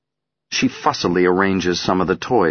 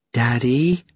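put-on little girl voice; 6 semitones higher than usual. Child calling father.

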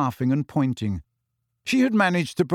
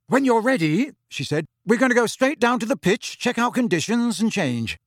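brisk team captain; 9 semitones higher than usual. Acted. Captain telling the players to go, higher and brisk. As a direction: get the team moving. Angelina voiced by narrator.

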